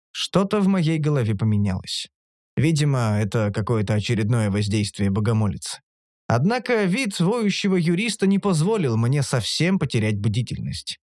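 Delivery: usual speaking voice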